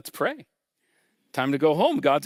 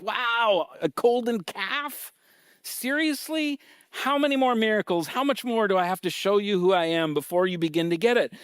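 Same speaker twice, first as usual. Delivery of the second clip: higher, incredulous and fed up; frustrated God; 7 semitones higher than usual. Retold or imitated. God scolding the people over the golden calf, exasperated. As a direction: play an exasperated God who is done with them. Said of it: "He's frustrated with the people".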